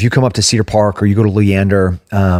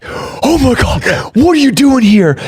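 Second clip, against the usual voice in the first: much higher, slow excited fan squeal; starstruck fan. Retold or imitated. Imitating a starstruck fan spotting a fighter, high squeal. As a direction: gush like a starstruck hockey fan. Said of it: "somebody being like".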